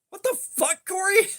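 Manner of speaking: high disbelieving cry; shocked outburst. Retold or imitated. Disgusted friend shouting at Corey in disbelief.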